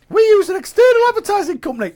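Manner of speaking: high, quick squeaky reading. Retold or imitated. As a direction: read it in a silly high voice. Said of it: "why does he speak in such a high voice".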